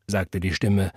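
ordinary delivery, nothing marked

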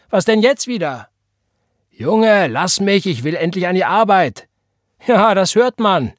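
high, loud call from the other room. A narrator's reading. John and Elisha call loudly to each other across the rooms. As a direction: shout so he hears you through the wall.

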